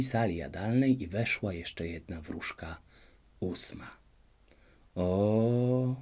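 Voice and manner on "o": frightened gasp. A narrator's reading. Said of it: "szepnął król przerażony".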